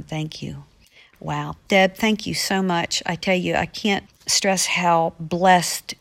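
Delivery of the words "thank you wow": soft whispered thanks, touched; moved whisper. Real, not acted. [name] is touched by a grandmother's letter, whispering. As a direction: breathe it out, deeply moved. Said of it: "moved, whispers".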